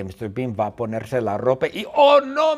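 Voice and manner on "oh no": high loud panicked oh no; alarmed cry. Acted. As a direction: squeal in sudden alarm. Mr Bean sees dentist sign. (grito agudo de alarma)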